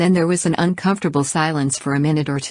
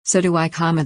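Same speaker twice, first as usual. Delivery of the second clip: ordinary delivery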